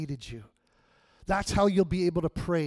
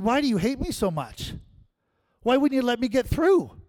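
high, loud griping at God; petulant grievance, higher; aggrieved whine; whiny complaint; 7 semitones higher, 4 dB louder than usual. Retold or imitated. Complaining to the Lord.

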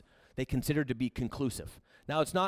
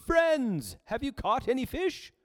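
calling out; 10 semitones higher, slower than usual. Acted. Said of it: "He called out".

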